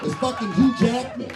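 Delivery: normal talking voice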